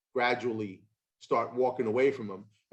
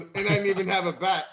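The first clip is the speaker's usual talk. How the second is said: higher, louder line broken by laughter; laughing; 7 semitones higher, 3 dB louder than usual. Retold or imitated. Dad's punchline retold to the host, laughing.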